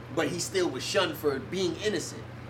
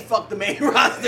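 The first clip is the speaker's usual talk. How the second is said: laughing quote; 5 semitones higher, 6 dB louder than usual. Retold or imitated. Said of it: "Triple H said".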